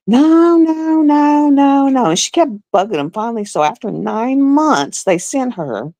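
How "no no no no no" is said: high, louder repeated no; mock doctor refusal. Doctor waving off the patient, high loud no. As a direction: brush her off, no no no.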